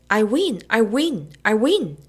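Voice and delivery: loud triumphant chant; boy whooping after winning the bet; yelling. A narrator's reading. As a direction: shout it over and over in victory.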